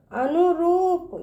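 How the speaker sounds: slow अनुरूप, रू held long; drawn-out long ū. Shown as a demonstration. Teacher showing how to read it, अनुरूप with long ū. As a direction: stretch the ū in अनुरूप. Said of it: "इस तरह से पढ़ेंगे".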